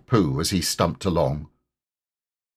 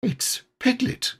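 high, eager exclamation; lifted, eager character voice; eager cry; 4 dB quieter than usual. A narrator's reading. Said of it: "cried Pooh eagerly".

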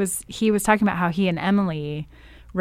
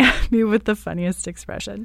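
ordinary delivery

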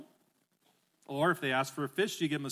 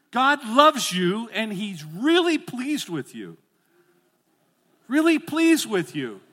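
higher, louder, slow heartfelt declaration; really mean it; 6 semitones higher, 8 dB louder, slower than usual. Shown as a demonstration. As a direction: say it like you truly believe it. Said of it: "I want you to really mean it this time".

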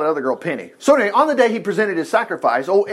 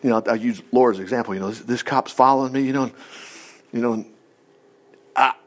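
softer, lower rambling plea; mock excuse prayer; 8 semitones lower, 5 dB quieter, faster than usual. Preacher mocking someone praying out of a ticket, soft. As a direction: mumble excuses to the Lord. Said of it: "we'll talk ourselves out".

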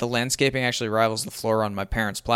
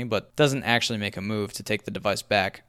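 usual speaking voice

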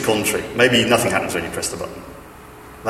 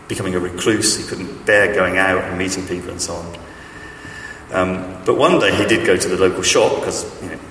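ordinary talk

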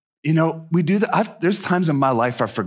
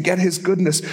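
ordinary talk, nothing marked

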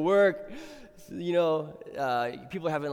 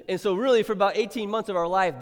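ordinary talk, nothing marked

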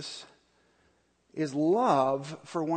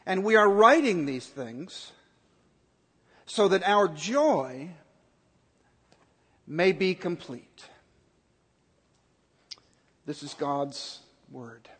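scripture reading; 3 dB louder than usual. Acted. Pastor reading scripture to the congregation, solemn. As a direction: read the passage with weight.

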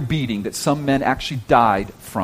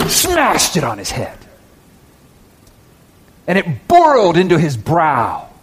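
forceful preaching; 4 semitones higher, 4 dB louder than usual. Real, not acted. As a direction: drive the verbs out hard and loud. Preacher dramatizes the crown being forced on.

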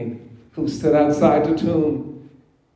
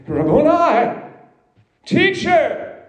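rising cry, much higher on "teacher"; cried out; 8 semitones higher, 3 dB louder, slower than usual. A narrator's reading. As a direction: call out to him in joyful recognition. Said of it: "she cried out in Aramaic".

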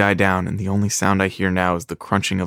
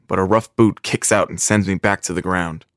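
normal talking voice

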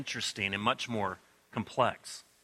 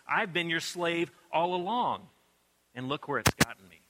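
resentful retort; 4 semitones higher, 6 dB louder than usual. Acted. Older brother's resentful retort.

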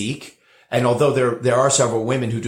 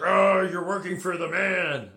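mocking; 7 semitones higher, 4 dB quieter than usual. Mocking people who criticize him.

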